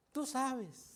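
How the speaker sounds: softly acted touch of sadness; retold sadness. Dalila, saddened, pleads with Samsón, soft mock sadness. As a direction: say it quietly, playing a little hurt. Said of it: "se pone muy triste".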